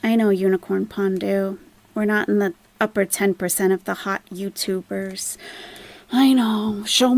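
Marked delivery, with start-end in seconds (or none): none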